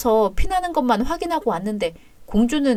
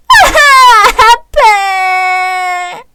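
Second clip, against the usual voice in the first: put-on child crying in pain; 9 semitones higher, 8 dB louder, much slower than usual.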